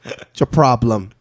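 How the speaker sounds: put-on loud, repetitive Boston-accent rant; Boston pigeon. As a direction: squawk like a pushy Boston bird. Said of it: "Like a Boston pigeon just says".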